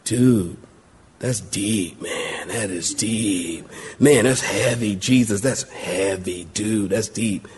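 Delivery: low, very soft, slow dude voice; hushed mock profundity, impressed and laid-back; awestruck dude. Disciples pretending to be awed by Jesus, low slow dude voice. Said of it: "look at Jesus and go".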